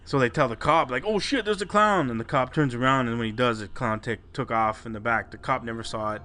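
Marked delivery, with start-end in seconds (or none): 1.1-2.1 high-pitched imitated alarm; retold panicky cry, pitched way up; mock alarm. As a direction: act out kids urgently warning a cop. Boys alert cop to clown.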